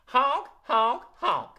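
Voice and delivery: put-on loud, sassy goose honks again with the kids. Shown as a demonstration. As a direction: honk along like a cheeky goose. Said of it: "Try that with me".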